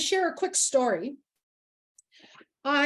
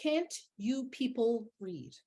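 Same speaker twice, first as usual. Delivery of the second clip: softer, slow, weighted echo of the quote; quiet repeat; 6 dB quieter, slower than usual. Retold or imitated. Speaker repeating the woman's remark to the room, quiet and deliberate. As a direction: let each word sink in, hushed.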